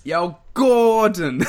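loud, drawn-out mock Italian American; Italian American accent. Echoing the co-host's owner impression, Italian American accent. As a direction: copy the hammed-up Italian American call, half laughing. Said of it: "make you do an Italian American accent".

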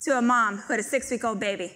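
ordinary talk

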